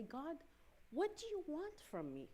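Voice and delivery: exasperated plea. Retold or imitated. John fed up, crying out to God, pitched high. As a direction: throw up your hands at God.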